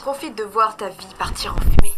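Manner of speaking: loud cruel taunt. Acted. Martha taunting the tied-up husband, loud cruel threat. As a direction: hurl it at him.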